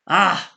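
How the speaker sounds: sharp sudden hiccup; loud hiccup. Real, not acted. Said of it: "Terribly sorry about this".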